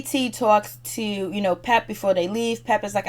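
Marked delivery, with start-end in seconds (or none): none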